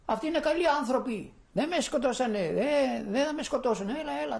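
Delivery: father calling out eagerly that the Germans are good people; eager reassurance. Retold or imitated. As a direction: hurry the child over, reassuring him. Father reassuring son, urging him over.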